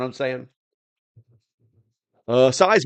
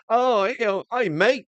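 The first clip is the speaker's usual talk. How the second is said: lightly voiced British greeting, much higher, with an Australian slip; a quick, much higher British accent that drifts Australian; mock British accent; 12 semitones higher than usual. Streamer imagines British customs officers greeting him, in a mock British accent. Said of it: "that sounded more Australian".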